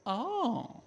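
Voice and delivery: a high startled little oh; mock surprised oh. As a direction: act out the receptionist's startle. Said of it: "She goes".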